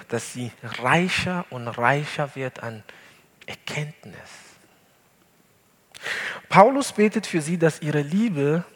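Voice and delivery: ordinary delivery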